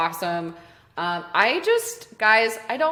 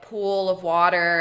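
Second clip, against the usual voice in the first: usual speaking voice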